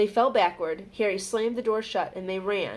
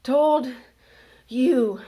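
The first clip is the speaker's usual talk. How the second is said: out of breath, loud; gasping for air; 5 semitones higher, 8 dB louder, much slower than usual. A narrator's reading. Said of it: "Hermione gasped".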